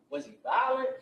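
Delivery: mock pushy officer; pressing question. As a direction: press her hard for a yes.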